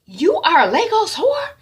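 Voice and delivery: high, loud scandalised question; outraged accusation. Retold or imitated. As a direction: hurl it in acted outrage. Sister's shocked accusation.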